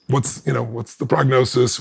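ordinary delivery

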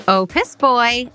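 ordinary delivery

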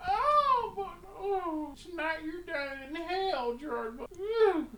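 put-on high, quiet drunken warbling; drunken sing-song. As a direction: wobble off high and tipsy.

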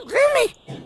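cartoonish high-pitched voice; changed squeaky voice. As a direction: squeak it out like the pills changed your voice. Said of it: "me está cambiando la voz".

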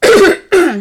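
loud sudden cough; coughing fit. Real, not acted. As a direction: burst into a hacking cough. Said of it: "mi è venuto un attacco di tosse". (attacco di tosse)

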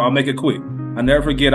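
ordinary delivery

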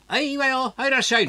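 高い女言葉の接客口調; 店のおかみをまねて高い声で客を迎える; おかみさんの声. Retold or imitated. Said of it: "おかみさんいいっつったら". (飲み屋のおかみ風に高い声で)

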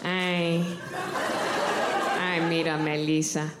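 put-on low, slow old Mexican abuela cooing; great grandma voice. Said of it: "that's my great grandma's voice".

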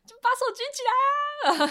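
high-pitched hammed-up call from the coach; mock coach yell. Coach calling out at jump. (模仿教练高声起哄)

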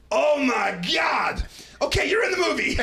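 high, slow amazed shout; French Canadian cameraman. Retold or imitated. Cameraman shouting in amazement after the crash. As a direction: gasp it out high, stretched. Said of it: "little French Canadian, he's like".

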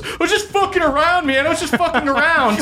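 very high, laughing, flustered protest; panicked excuse. Acted. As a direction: backpedal frantically in a high squeal. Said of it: "is going to get her sword and goes".

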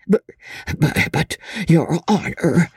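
high, faltering protest; cowed old man tripping over his words; frightened stammer. A narrator's reading. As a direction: stutter a timid objection, dragging out the last words. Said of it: "stammered the poor wretch".

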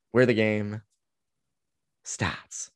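hushed slogan in a put-on Boston accent, pause before "starts". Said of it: "to do the Boston accent".

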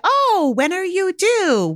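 high, drawn-out cooing question; mock delighted mom. Imitating mom's rude pregnancy remark.

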